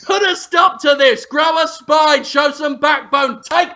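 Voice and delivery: high, goading chef rant; hammed-up indignant yelling; mock outraged shouting. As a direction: demand to be stopped in mock fury. Acted Ramsay goading maitre d'.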